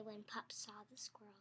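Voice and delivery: suspenseful whisper. Acted. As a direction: hush it for suspense. Suspense in story.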